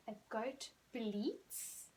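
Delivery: very slow, deliberate model sentence; slow teacher model. Shown as a demonstration. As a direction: drag it out so children can copy. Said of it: "We say it bleats".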